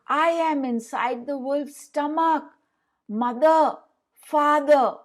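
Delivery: high, loud call for his parents; Tom crying out. A narrator's reading. Tom calls out to his parents from the wolf's belly, high and loud. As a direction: shout from inside the wolf. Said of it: "Tom cried".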